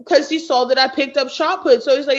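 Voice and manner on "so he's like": normal talking voice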